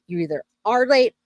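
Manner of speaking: ordinary talk, nothing marked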